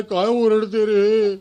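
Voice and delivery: slow, uneasy question; frightened sahib. Acted. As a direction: ask slowly, spooked by the noise. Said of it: "ते घाबरले".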